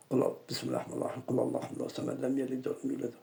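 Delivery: murmured Quran recitation, low and soft; low quiet recitation. Retold or imitated. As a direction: recite it under your breath. Recites verses to the djinn. (récitation coranique murmurée)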